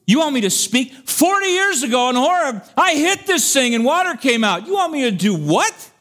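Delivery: high, loud disbelieving outburst; can't believe what he's being asked; incredulous Moses. Acted. Moses questioning God's order to speak, high incredulous. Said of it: "he's thinking to himself".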